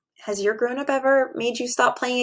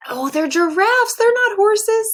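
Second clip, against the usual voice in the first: higher, louder, rising in surprise; surprised self-correction; 10 semitones higher, 4 dB louder, slower than usual. Real, not acted. Teacher laughing at her own mistake, surprised. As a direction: catch your own silly mistake.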